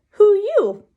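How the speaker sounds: put-on high, loud child's voice; very high toddler question; little kid. A narrator's reading. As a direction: ask like a blunt small child. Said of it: "he said".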